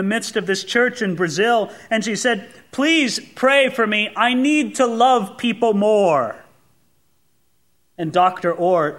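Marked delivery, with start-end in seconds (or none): 2.7-6.4 slow, higher earnest plea; retold pious request for prayer; pleading churchgoer. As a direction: voice a drawn-out, mildly earnest plea. Woman pleads in church story.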